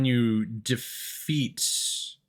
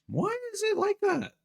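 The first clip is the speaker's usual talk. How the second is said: much higher, squeaky disbelieving whine; exasperated squeak. Real, not acted. Music still playing despite muting.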